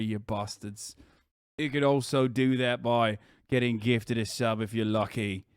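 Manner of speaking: put-on grumbling British bloke, English accent. Streamer reading the ad break to viewers, put-on English accent. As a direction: do a low-key cheeky Brit accent.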